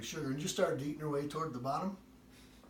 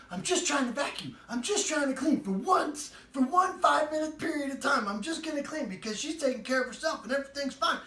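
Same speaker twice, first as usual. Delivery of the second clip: exasperated whine; 9 semitones higher, 5 dB louder than usual. Retold or imitated. Wife's exasperated retold complaint.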